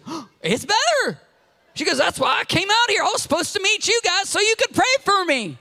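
put-on high, eager old lady; lightly voiced old lady. Old lady explaining why she came, high-pitched. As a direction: tell it with wonder.

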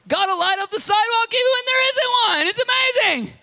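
high, loud proclamation; fervent preaching. Real, not acted. Preacher proclaiming to the church, full-force preaching. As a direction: shout the wonder out.

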